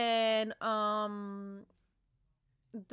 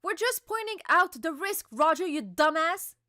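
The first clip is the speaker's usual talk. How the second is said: higher, louder exasperated retort; annoyed crewmate; 6 semitones higher, 4 dB louder than usual. Retold or imitated. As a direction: scold a reckless friend. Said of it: "And he's like".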